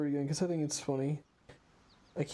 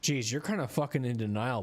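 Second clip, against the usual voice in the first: ordinary talk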